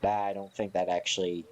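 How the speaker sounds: normal talking voice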